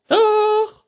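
very high, loud retort; princess calling out. A narrator's reading. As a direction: shriek back stubbornly as princess. Princess figure contradicting. (trotziger, hoher Prinzessinnenschrei)

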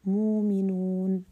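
teacher demonstrating ibdal on a Quranic word; hamza replaced by long u. Shown as a demonstration. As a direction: stretch مؤمنون with a long u in place of the hamza. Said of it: "تبدل حرف مد".

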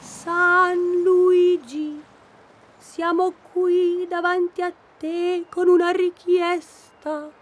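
high, very slow solemn plea; solemn invocation. Acted. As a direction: intone it like a prayer. Solemn prayer to statue.

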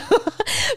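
short high giggle; brief amused laugh; real laugh. Real, not acted. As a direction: crack up at your own joke. Laughs at own apology.

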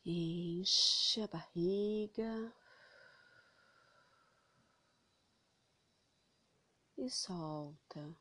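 slow, soft meditation voice; slow breath counting. Acted. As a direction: guide each breath in and out, slowly. Guides breathing slowly.

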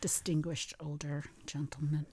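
soft whispered aside; whispering. Real, not acted. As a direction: whisper it under your breath. Aside during episode talk.

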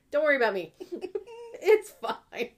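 loud, laughing fake reassurance; hammed-up self-pity breaking into laughs; laughing mock martyr. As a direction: wave them off through rising laughter.

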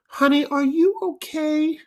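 put-on high, sweet old lady. Old woman fretting over a boy, high and sweet. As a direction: coo it like a worried grandma. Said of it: "she goes".

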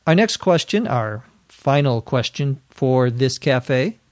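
ordinary talk

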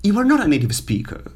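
high, fast mock objection; skeptical student. Teacher voices a doubting student, high and fast. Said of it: "you are going to say".

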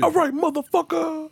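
mock stripper. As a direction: toss it off like a bored stripper. Voicing stripper dropping on you.

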